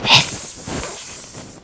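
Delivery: long, soft voiceless hiss; play-acted snake hissing; big snake hiss. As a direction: stream the S out like a snake. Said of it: "and hiss?".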